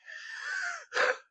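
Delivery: a thin, airy wheeze on the inhale; wheezy breath. Real, not acted. Streamer wheezing on a live stream, real wheezy breath. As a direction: let the breath rasp out unvoiced. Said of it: "Y'all hear that?".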